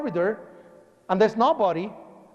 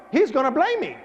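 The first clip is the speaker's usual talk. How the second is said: raised, insistent mock worry; higher-pitched fretting; worried; 8 semitones higher than usual. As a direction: retell a mild panic for laughs. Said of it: "Because I was worried".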